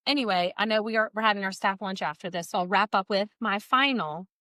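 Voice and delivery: ordinary talk, nothing marked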